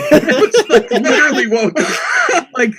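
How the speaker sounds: high, loud laugh breaking into the words; cracking up in disbelief. Real, not acted. Customer laughing at the supervisor's refusal, in high disbelief.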